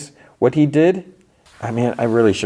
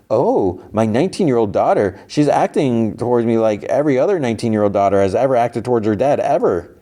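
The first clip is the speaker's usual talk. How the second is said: higher-pitched mock whining; exaggerated mimicking of a complainer; mocking complaint; 4 semitones higher than usual. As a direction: parody someone's grievance, pitch swooping up. Character mocks his complaint back.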